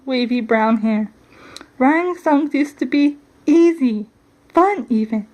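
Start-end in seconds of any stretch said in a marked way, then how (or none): none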